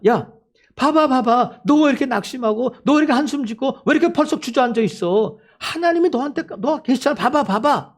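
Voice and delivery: high-pitched insistent pleading; urgent exhortation. Acted. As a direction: urge a disheartened friend to look up. Acts people urging the discouraged. (높은 목소리로 다그치듯 격려)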